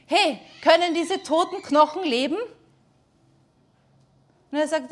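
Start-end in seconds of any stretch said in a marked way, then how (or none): 0.1-2.4 higher and louder, a challenging call; voice of God. Retold or imitated. God asks Ezekiel about the dead bones, loud and higher. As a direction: call out the question like God testing the prophet. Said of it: "Und Gott sagt zu ihm".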